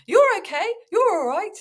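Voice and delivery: very high, loud mock reassurance; parent soothing a child. Showing the approach that doesn't help anyone, exaggerated high-pitched reassurance. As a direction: coo it high, as to a small child. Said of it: "which you might say to a child".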